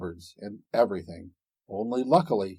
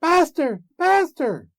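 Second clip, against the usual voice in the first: very high loud call; magic harp crying out to wake the ogre; 7 dB louder than usual. A narrator's reading. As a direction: shriek for your master. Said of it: "the harp called out quite loud".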